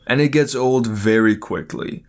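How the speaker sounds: ordinary talk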